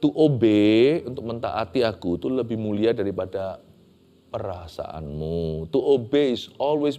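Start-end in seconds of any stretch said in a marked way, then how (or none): none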